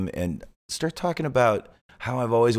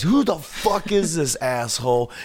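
hammed-up rant at the intruder; mock angry yell; 10 semitones higher than usual. [name] yelling at the host to a Velocity Girl guy, hammed-up angry yell. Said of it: "he yells at one of the Velocity Girl guys".

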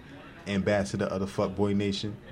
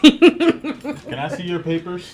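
mock cop; 10 semitones higher, 8 dB louder, much slower than usual. Mock cop stop, laughing.

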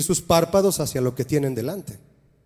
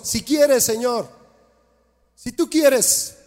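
higher, slow, pleading; pleading leper; 6 semitones higher, much slower than usual. Acted. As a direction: beg like the leper at Jesus' feet. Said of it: "como aquel leproso". (súplica lenta y aguda del leproso)

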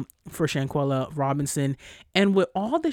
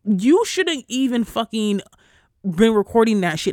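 pissed-off rant; 5 semitones higher, 5 dB louder than usual. Real, not acted. Podcaster scolding the friends who filmed it, furious. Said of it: "I know I sound so pissed off".

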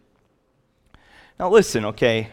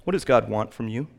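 usual speaking voice

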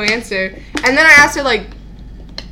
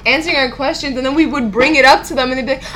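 normal talking voice

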